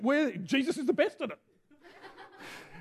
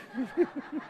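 amused laugh; 9 dB quieter than usual. Real, not acted. Preacher laughs at his loud yell.

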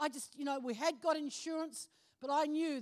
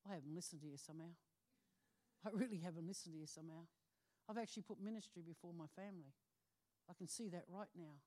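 hushed, fast inner confession; quiet remorse; 8 semitones lower, 13 dB quieter, faster than usual. Retold or imitated. Woman confessing to herself she neglected family, hushed. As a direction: murmur the realisation to herself, quickly. Realising she put ministry first.

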